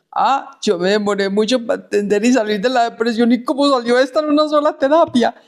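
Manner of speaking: high, rising amazement at the patient; amazed. Retold or imitated. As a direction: marvel at how fast it went, pitch climbing. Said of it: "yo decía".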